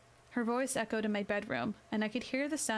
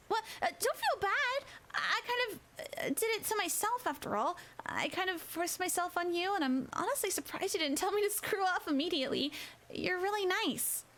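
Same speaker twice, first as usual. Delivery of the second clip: much higher, eager schoolgirl; flustered girl voice; 9 semitones higher than usual. A narrator's reading. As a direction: reassure him, bashful and bright. Kikamura reassures, flustered.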